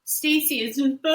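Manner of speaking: high, loud mock crying; hammed-up tearful babble; imitated breakdown. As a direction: dissolve into wordless pretend wailing. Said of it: "She's doing that".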